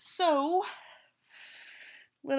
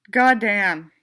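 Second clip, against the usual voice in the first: loud low goddamn; 7 dB louder than usual. Real, not acted. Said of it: "three takes of goddamn".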